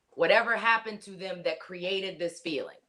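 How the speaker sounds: usual speaking voice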